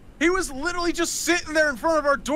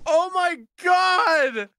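panicking gamer; 7 semitones higher, 4 dB louder, much slower than usual. Real, not acted. Aftermath of jump scare.

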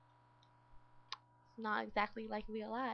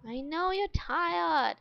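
frustrated; 6 semitones higher, 9 dB louder, slower than usual. Real, not acted. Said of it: "I can't handle it".

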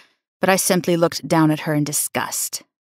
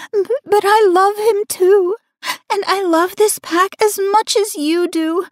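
high pleading voice, a stammer; tearful plea; 12 semitones higher than usual. A narrator's reading. Mistress pleading with the Luna, tearful high voice. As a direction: beg her not to hate you.

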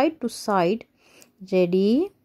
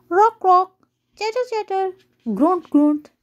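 silly animal noises; 8 semitones higher, 3 dB louder than usual. Acted. Animals try a dinosaur roar.

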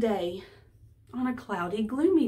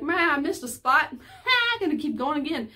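laughing mock excuse; 6 semitones higher than usual. Mock excuse for extra mowing laps.

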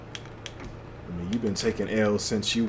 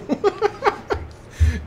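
high cackling laugh; 3 dB louder than usual. Real, not acted. Cracking up at game sound.

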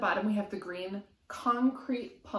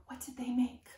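soft whispered question; 3 semitones higher, 3 dB quieter than usual. Real, not acted. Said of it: "whispered question to child".

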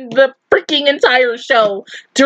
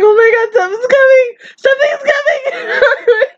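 retold panic; 9 semitones higher than usual. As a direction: relive the moment you sensed danger, voice shooting up. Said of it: "and I'm like".

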